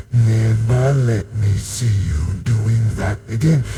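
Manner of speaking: slow, measured mock telling-off; funny dad imitation. Brother mimicking dad at bedtime, funny stern voice. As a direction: spell out each word like a scolding father. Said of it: "just like my dad, going".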